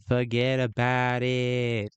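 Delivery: slow, drawn-out mock Brooklyn accent. User imitating someone from Brooklyn, Brooklyn accent. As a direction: stretch it out like a Brooklynite. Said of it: "what would someone from Brooklyn say".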